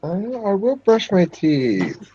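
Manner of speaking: high, drawn-out whine sliding down; whiny. Real, not acted. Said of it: "such a whiny way".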